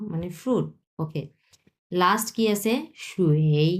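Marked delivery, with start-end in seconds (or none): 3.1-3.7 সুয়েই dipped low, third tone. Shown as a demonstration. Teacher models third tone on সুয়েই. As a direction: drop সুয়েই low into the dip. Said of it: "তিন number tone".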